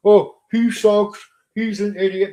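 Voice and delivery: mocking imitation. Streamer imitating people who misjudge him, loud and mocking. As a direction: drag out the insult, imitating haters. Voices his online detractors.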